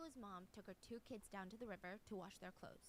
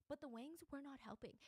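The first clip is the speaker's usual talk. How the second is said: ordinary talk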